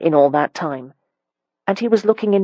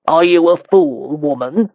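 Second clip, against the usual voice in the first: a slow, pointed jab at her; mild scornful demand; 3 dB louder than usual. A narrator's reading. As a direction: ask it slowly with a touch of contempt. Marquis insults her.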